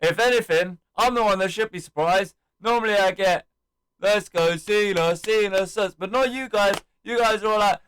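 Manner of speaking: re-enacted hyped-up promo; imitated wrestling promo. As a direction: work the crowd like a wrestler on the mic. Acts out Cena's promo to crowd.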